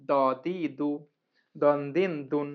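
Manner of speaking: ordinary talk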